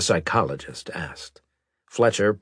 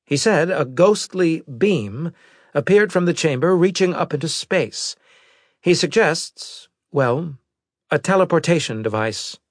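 higher-pitched officer, slowing to hesitate; intelligence officer; 11 semitones higher, 5 dB louder than usual. Acted. Officer relaying a survivor's odd theory, hesitant high voice. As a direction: report haltingly in a raised pitch.

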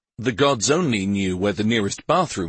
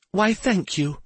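high, chiming little fairy; tinkling, pitched well up; 10 semitones higher than usual. A narrator's reading. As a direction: chime it out like a dainty fairy. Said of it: "in her tinkling chime voice".

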